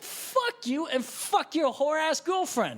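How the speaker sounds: high, slow retold outburst; losing it. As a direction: draw out the insult in a mock rage. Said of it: "I'm losing my shit".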